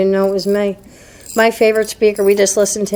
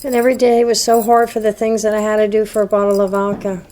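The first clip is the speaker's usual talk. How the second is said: ordinary delivery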